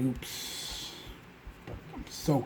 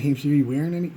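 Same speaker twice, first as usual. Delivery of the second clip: sister's teasing question; 5 semitones higher, 12 dB louder than usual. Retold or imitated. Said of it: "She thought I was kidding".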